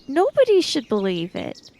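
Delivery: ordinary talk, nothing marked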